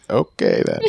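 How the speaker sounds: low, falling shrug of a reply; dismissive. Retold or imitated. Son gives up on the argument, low dismissive shrug. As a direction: let it drop, low and curt. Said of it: "he was just kinda like".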